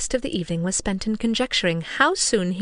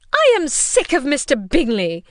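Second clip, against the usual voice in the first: higher, louder exasperated mother; cried out; 7 semitones higher, 7 dB louder than usual. A narrator's reading. Wife complaining to husband, loud and exasperated. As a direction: fling it out in vexation. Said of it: "cried his wife".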